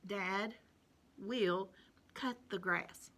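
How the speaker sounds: Dad will cut the grass said softly, word by word; soft dictation. Shown as a demonstration. Teacher dictating the sentence softly for children. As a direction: dictate it gently. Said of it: "you're gonna write in cursive".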